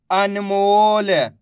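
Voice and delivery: very drawn-out model word; slow blended reading. Shown as a demonstration. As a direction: stretch every syllable while joining them into one word. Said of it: "इनको आपस में मिला के पढ़ना है". (अक्षर मिलाकर खूब खींचकर पढ़ना)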